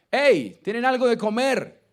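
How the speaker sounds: loud, high call across the water. Acted. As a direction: shout it to the boat from the shore. Jesus calls from the shore.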